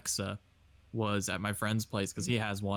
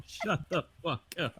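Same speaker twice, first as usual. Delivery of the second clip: talking through high laughter; laughing; 3 semitones higher, slower than usual. Real, not acted. Speaker reacting to a hated meme, high laughing protest. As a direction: squeeze the words out while laughing.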